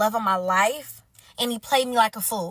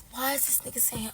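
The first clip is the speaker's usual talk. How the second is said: ordinary delivery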